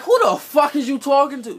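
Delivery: high, loud furious yell; screaming back at her in my head; imagined outburst. Retold or imitated. Yelling at the driving examiner, high and furious. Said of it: "in my head".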